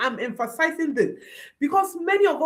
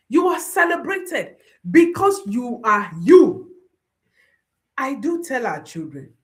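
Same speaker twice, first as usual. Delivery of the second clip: ordinary talk, nothing marked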